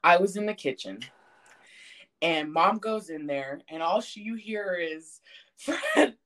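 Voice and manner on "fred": high, raised-voice scolding, imitated; alarmed telling-off, pitched way up; mom calling out. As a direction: act out mom yelling from another room. Mom yelling from the bathroom, retold.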